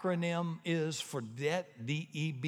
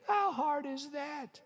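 rhetorical question; 11 semitones higher, slower than usual. Real, not acted. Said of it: "rhetorical, preacher pitch".

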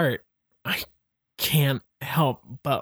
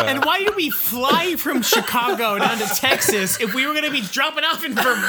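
high, fast laughing protest; 10 semitones higher than usual. Real, not acted. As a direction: crack up while complaining. Laughing complaint about route.

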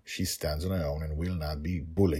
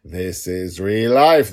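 slow, loud declaration of each word; firm and forceful, stretched out; emphatic; 10 dB louder, slower than usual. Real, not acted. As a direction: spell out that this is real life. Insisting fiction is real life.

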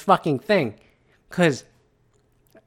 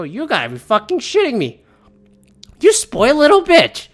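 angry outburst; 6 semitones higher than usual. Retold or imitated. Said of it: "I just go".

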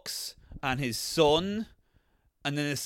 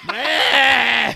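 loud grunting yell, high and rough; caveman grunt; 9 semitones higher, 12 dB louder than usual. Retold or imitated. Friend mocks the thawed-out guy with a grunt. Mocking frozen guy waking.

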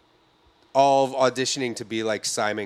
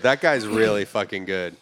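normal talking voice

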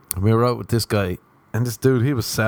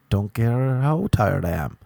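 ordinary delivery

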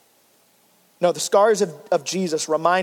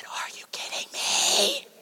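much higher, strained, breathless whisper; disbelieving whisper; 9 dB quieter, slower than usual. Retold or imitated. Husband to wife with a dead phone, stunned whisper. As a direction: squeeze out stunned disbelief, slow.

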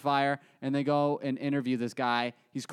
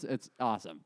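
usual speaking voice

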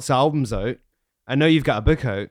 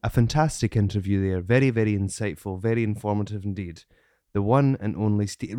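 low, smooth, formal sign-off; put-on presenter voice; 4 semitones lower, 3 dB quieter than usual. Shown as a demonstration. Host retrying outro as co-host stifles laughter, low presenter voice. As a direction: deliver a grave show outro. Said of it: "gonna do it again".

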